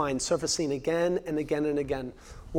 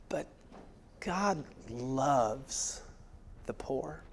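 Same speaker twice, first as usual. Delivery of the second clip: hushed emphasis; 4 semitones lower, 11 dB quieter, much slower than usual. Shown as a demonstration.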